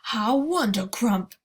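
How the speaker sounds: loud, slow joyful exclaim; the giant overjoyed; delighted cry. Acted. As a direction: beam and cry it out. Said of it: "cried the B F G".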